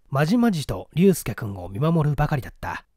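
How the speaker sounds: ordinary talk, nothing marked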